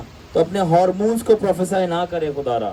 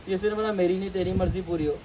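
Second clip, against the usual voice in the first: usual speaking voice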